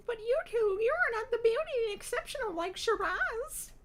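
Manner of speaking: haughty, self-admiring falsetto; very high, loud put-on voice, full of herself; Shiraz. A narrator's reading.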